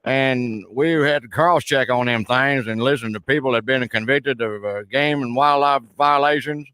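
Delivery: hammed-up backwoods Southern caller; put-on rural Southern accent. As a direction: play a blustering Southern good old boy on the phone. Roy Mercer character voice held.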